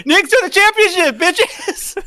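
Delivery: loud, high victory yell; triumphant shout. Real, not acted. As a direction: scream it like you won. Celebrating Knicks title.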